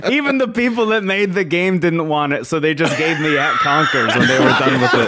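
high laughing through words; barely holding it together laughing. Real, not acted. Cracking up at own joke.

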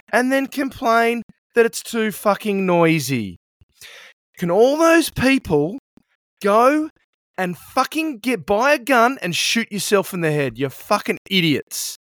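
angry rant. Real, not acted. Ranting about noise complainers.

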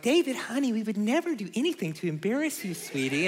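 soft, sugary mom voice; sweet mom impression. Mom reassuring her son she won't embarrass him, sweet mom voice. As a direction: imitate a doting mother, softly sing-song. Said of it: "This is how she talks".

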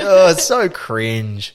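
slow, drawn-out cringe, half laughing; cringing at himself. Retold or imitated. Looking back on his chubby arrogant kid self, slow wincing cringe. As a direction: stretch it out like you're wincing at the memory. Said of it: "It's like".

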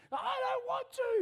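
put-on high, slow shrieking toddler refusal; whiny child shout. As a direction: scream it like a three-year-old who won't budge. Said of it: "child screaming back".

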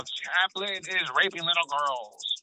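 put-on slow old-timey newsreel announcer; a hint of a newsreel narrator; 1950s narrator. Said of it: "the old timey newsreels".

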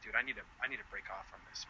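soft, fast muttered decision; quiet resolve. Retold or imitated. Realizing he must leave the meme behind, quiet and fast. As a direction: say it low to yourself, quick. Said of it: "which I was like".

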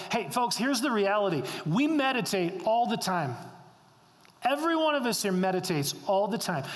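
normal talking voice

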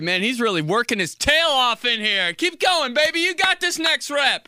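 high, falsetto cheering voice; high falsetto mock encouragement; admiring gym onlooker. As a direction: cheer him on like an impressed stranger.

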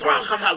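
high burst of mock goblin gibberish; imitated Goblin speech. Game master imitating a goblin outside, sudden gibberish outburst. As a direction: babble like a goblin. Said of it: "like, speaking in, like, Goblin".

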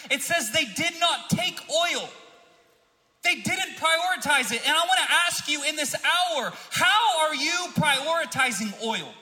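high, driving sermon delivery with punched key words; impassioned preaching. Real, not acted. Preacher urging church to prioritize God's presence, impassioned preaching. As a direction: preach it at full force, pitch held up high.

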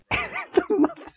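a high burst of real laughter; the game master cracking up. Real, not acted. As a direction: laugh at your own line. GM laughing at motherland.

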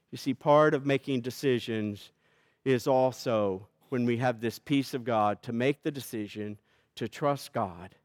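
ordinary delivery

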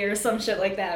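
usual speaking voice

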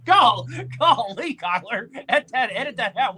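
laughing, mock-scandalized scold. Real, not acted. Host laughing, scolding guest over crude joke on air. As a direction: laugh while telling him off for swearing.

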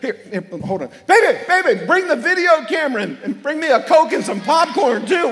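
high, loud delighted squeal; hammed-up thrill at the tantrum show; gleeful parent calling for camera and popcorn; mock excited dad. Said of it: "I'd be like".

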